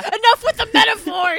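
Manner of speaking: high-pitched mock command; Kirk quote. Quoting Kirk scolding McCoy, high mock command. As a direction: imitate an exasperated captain giving orders. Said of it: "I think it's Kirk".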